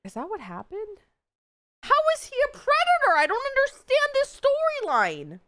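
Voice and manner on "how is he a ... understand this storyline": high, loud incredulous outburst; confused squeal at the plot twist; baffled. Real, not acted. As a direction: exclaim in total disbelief.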